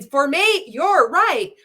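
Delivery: high, loud mock presenter read; hammed-up, swooping sales pitch; exaggerated promo practice. Shown as a demonstration. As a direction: overdo it like a stiff sales video. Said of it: "when you put up your phone and you're practicing".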